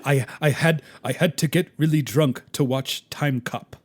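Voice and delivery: lightly imitated captain, emphatic delivery; a hint of a put-on commander; Captain Sisko. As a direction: confess it with mock gravity, captain-style. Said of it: "you can just hear Sisko's voice".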